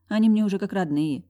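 ordinary delivery, nothing marked

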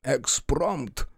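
high, drawn-out haughty answer. Acted. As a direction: stretch it out loftily, then drop. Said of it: "Бутон высокомерно".

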